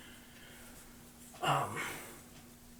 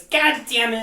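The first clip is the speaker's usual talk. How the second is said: higher, louder retold curse; Kessel yell; 7 semitones higher, 8 dB louder, slower than usual. Recalling a clip of Kessel, a higher, louder curse. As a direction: bark it like the player in the clip. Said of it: "He goes".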